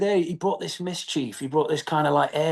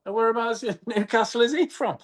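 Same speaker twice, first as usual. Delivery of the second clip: high-pitched lapse from Geordie into Scouse; slipping into Scouse; 8 semitones higher than usual. Retold or imitated. Soldier drifting out of Geordie halfway through, slipping into Scouse. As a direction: let the Scouse accent creep into the line. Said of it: "start doing him Scouse".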